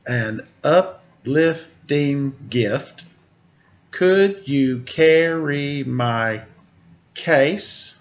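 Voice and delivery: slow, measured haiku reading; haiku recital. Shown as a demonstration. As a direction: read it slowly like a poem. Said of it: "is speaking in what's called a haiku".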